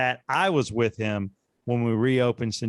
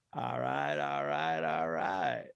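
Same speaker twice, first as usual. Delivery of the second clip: low, soft laid-back drawl; Matthew McConaughey; 9 semitones lower, 7 dB quieter, much slower than usual. Acted. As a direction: drawl it lazy and low like a Texan charmer. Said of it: "Matthew McConaughey".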